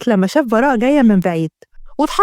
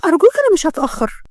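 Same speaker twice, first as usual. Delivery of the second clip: put-on higher-pitched girlish plea; pleading; 5 semitones higher than usual. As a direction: beg him for permission, pitch lifted. Girl begs permission to visit father. (برجاء، بصوت أعلى طبقة)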